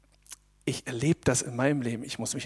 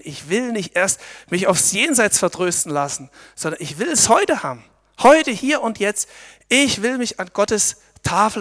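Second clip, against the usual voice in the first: rising, fervent declaration, louder at Heute; passionate resolve; 5 dB louder than usual. Acted. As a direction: proclaim it like a vow. Entschlossene Proklamation. (leidenschaftlich, entschlossen)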